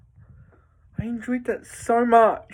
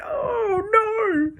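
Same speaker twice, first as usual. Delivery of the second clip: much higher, dismayed "oh no"; 12 semitones higher than usual. Retold or imitated. Retelling her reaction to a sad film moment, high dismayed cry. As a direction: pitch it way up in mock dismay. Reacting to Gwen Stacy scene.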